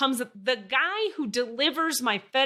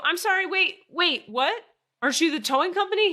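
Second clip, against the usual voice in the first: incredulous; 10 semitones higher, 5 dB louder than usual. Retold or imitated. Incredulous at towing guy.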